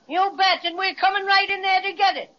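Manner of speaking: high, combative holler; calling defiantly. Acted. Bear backs up the star claim, calling defiantly. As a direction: shout a threat across the water. Bear calls threat to policeman ashore.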